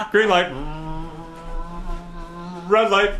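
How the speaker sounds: calling out commands. Pretend. Leader running red light green light, calling out. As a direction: call it out to the room.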